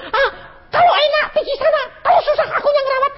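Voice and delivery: hammed-up grumble with a fake sob; complaining wife. Wife griping about nursing husband, whiny mock cry. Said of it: "tapi kamu belum-belum".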